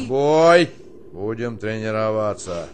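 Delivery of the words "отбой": loud barked order; shouted command. Acted. Colonel shouting lights-out to the private. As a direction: bark it like drill.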